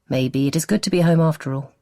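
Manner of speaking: plain reading voice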